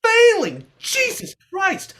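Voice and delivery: high, loud disbelieving cry; shocked outburst. Acted. Student shocked to hear he failed, loud disbelieving cry. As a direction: blurt it out in shock.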